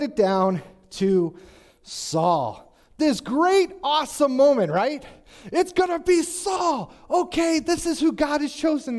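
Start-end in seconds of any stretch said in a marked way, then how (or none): 3.0-9.0 excited buildup. Acted. Preacher building up Saul's chosen moment, excited. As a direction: hype it up like the big reveal.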